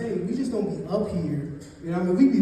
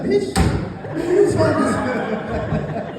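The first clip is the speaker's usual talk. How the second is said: exasperated retort; 5 semitones higher, 5 dB louder, much slower than usual. Retold or imitated. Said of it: "I'm like".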